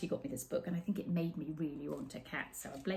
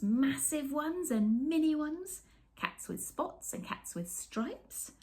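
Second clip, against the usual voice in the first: slow, bouncy rhyming delivery with bright high peaks; playful storybook reading; 7 semitones higher, slower than usual. A narrator's reading. Reading the cat rhymes to children, playful and delighted. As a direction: savour each rhyme for the kids. Reads picture book aloud.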